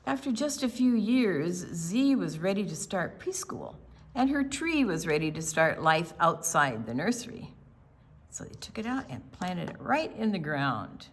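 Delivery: lively storybook read-aloud; picture book read to a group of children. Acted. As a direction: read it like storytime for little kids.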